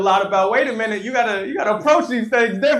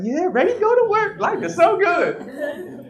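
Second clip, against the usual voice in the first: high, cheerful voice of his naive younger self; bright mock optimism, pitched up; 9 semitones higher than usual. Speaker mimics his younger self, cheerful and high.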